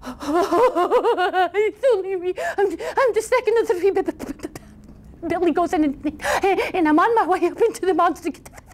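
high, quavering stammer; timid high voice, stuck on words; frightened second goat. Acted. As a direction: stutter in panic like a scared goat. Second goat answers the troll.

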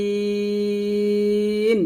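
আইন drawn out with pressure from mid-throat; pressed throat sound. Shown as a demonstration. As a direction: squeeze আইন from the throat, slowly. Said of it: "গলার মাঝখানে চাপ দিয়ে উচ্চারণ". (গলার মাঝখানে চাপ দিয়ে আইন)

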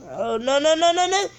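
rapid rising string of no's; panicked. Real, not acted. Gamer seeing trouble coming, panicked. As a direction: panic as it goes wrong. Game going wrong.

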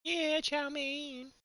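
high, very slow drive-thru voice; drive-thru speaker voice. Retold or imitated. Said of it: "you've never seen Dude, Where's My Car?".

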